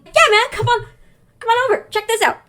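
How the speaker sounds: high-pitched, eager imitation of a buddy; hyped sports fan. Cheering Emmett invites Charlie over.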